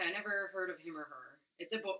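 ordinary talk, nothing marked

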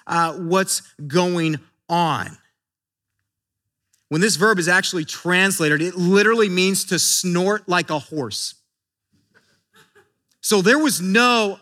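ordinary talk, nothing marked

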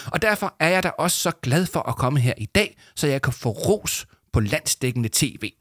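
self-congratulating story character; self-satisfied boaster. Acted. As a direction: brag proudly, stretching the final cheer.

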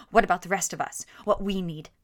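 loud, menacing demand; angry demand. A narrator's reading. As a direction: confront her, loud and hard. Vagrant confronts her.

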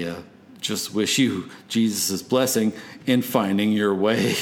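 ordinary talk, nothing marked

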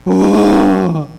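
loud wailing "Ah" as a sobbing woman; a mock anguished howl from the coffee shop; imitated sobbing cry. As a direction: let out her sob as a high, loud wail. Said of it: "She was just going".

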